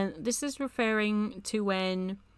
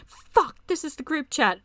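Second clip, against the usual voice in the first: loud, higher-pitched startled swear; startled curse; 6 semitones higher, 5 dB louder than usual. Acted. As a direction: blurt it out in sudden panic. Character realises wrong chat.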